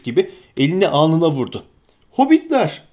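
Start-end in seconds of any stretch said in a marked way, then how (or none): 2.1-2.8 sudden high exclamation; shout. Acted. Innkeeper suddenly remembering hobbits, exclaiming. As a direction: cry out as it dawns on him. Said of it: "diye bağırdı".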